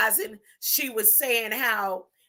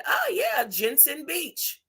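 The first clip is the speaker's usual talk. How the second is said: imitated mother; 2 semitones higher, 3 dB quieter, slower than usual. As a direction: lightly put on a mother's airy reply. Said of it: "So she said".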